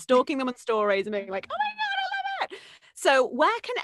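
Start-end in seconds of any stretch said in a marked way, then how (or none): 1.5-2.6 very high, breathless fangirl gush; delighted squeal. Retold or imitated. Coach imitating herself seeing a client's post, squealing.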